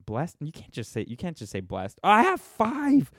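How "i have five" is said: high, slow, hammed-up squeal of joy; mock thrill. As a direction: gush like it's a huge milestone. Viewer count climbs to five.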